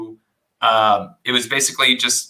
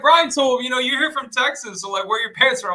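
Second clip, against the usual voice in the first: higher, lightly voiced nosy neighbor; imitating a neighbor; 11 semitones higher than usual. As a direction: play the nosy neighbor asking. Neighbors questioning him at cookout.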